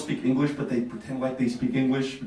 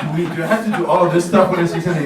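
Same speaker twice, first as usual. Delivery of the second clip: rapid, loud alarmed question; panicked fast question; 3 semitones higher, 6 dB louder, much faster than usual. Retold or imitated. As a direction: blurt it out in alarm. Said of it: "I'm like".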